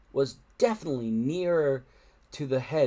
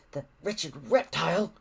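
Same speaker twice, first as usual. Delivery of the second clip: scolding; 4 semitones higher than usual. Acted. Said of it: "scolded Fishlegs".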